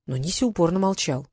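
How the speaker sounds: ordinary delivery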